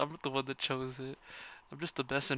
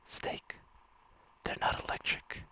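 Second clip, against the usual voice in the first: ASMR whisper story. Acted. Streamer whispers a fan story to chat, ASMR hush. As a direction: hush it all like a bedtime ASMR read.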